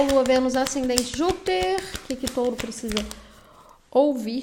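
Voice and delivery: ordinary talk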